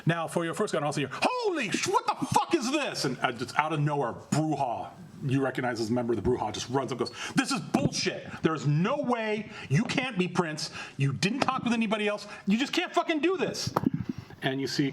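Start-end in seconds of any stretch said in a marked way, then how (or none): 1.1-3.1 high, slow, stunned disbelief; shocked outburst. Acted. A bystander seeing the new Prince's crew, stunned high exclamation. 7.3-13.7 high, indignant ranting rebel; angry protest. Acted. Rebel Brujah yelling at the new Prince, high angry ranting.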